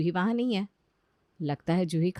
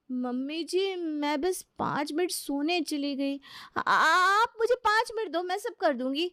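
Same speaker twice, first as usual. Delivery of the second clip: high, slow, coaxing plea; pleading bride; 9 semitones higher than usual. Acted. New bride pleading with mother-in-law. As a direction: beg for five more minutes.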